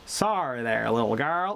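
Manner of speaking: low, slow Texas drawl. Shown as a demonstration. Explaining Texas speech, low drawled sorry. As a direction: let the chin hang for a Texan "sorry". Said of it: "get that drawl in it".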